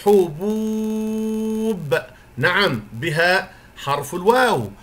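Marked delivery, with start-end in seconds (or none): 0.0-2.0 حبوب said very slowly and higher, the و held long; long vowel demo. Shown as a demonstration. Teacher presenting the last word to pupils: حبوب drawn out, long و. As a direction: stretch the و in حبوب out for the children. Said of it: "الكلمة الأخيرة".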